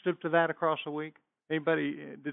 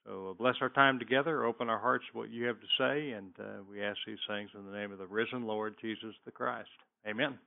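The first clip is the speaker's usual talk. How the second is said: quiet, lower prayer voice; hushed closing prayer before the lesson; 4 semitones lower, 7 dB quieter than usual. Acted. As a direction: pray softly, eyes closed.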